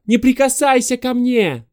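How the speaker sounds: high loud frightened shriek; shrieking woman. Acted. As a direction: shriek at him to keep away. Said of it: "завизжала она".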